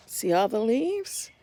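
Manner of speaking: usual speaking voice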